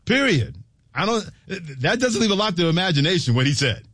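high-voiced comic agreement; high-pitched exclaiming. Real, not acted. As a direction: push it up high in disbelief and delight. Host amused, exclaiming over caller's line.

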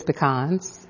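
pecans said slowly as puh-KAHNS; pecans with stress on a long "KAHNS"; puh-KAHNS pronunciation. Shown as a demonstration. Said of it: "in my family".

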